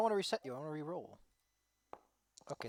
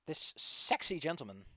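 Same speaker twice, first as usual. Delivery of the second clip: ordinary delivery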